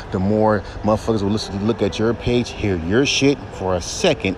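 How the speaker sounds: ordinary talk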